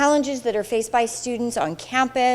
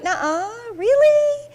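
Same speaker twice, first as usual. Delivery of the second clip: "Nuh-uh" then a much higher, louder "really?"; mock disbelief; 12 semitones higher, 4 dB louder, slower than usual. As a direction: play up the astonished disbelief. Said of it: "every day I'm like".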